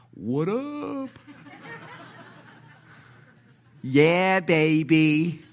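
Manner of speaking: high, playful, laughing hey-there voice; cool Jesus greeting. Acted. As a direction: give it a casual buddy swagger.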